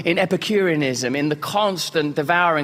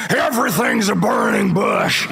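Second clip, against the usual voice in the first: booming slow proclamation; 6 semitones higher than usual. Retold or imitated. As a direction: boom it out slowly, as if the old vet is proclaiming. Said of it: "he said it like this".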